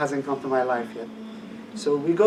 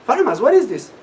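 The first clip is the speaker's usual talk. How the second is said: eager, louder question to a friend; excited question; 8 semitones higher, 7 dB louder, faster than usual. Retold or imitated. Asking his friend in the car what's playing, eager. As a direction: lean in, keen to know.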